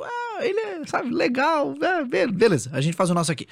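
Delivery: pitched well up, patronizing; high patronizing voice. Retold or imitated. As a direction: humor him in a high voice. Voicing scientists' patronizing thought.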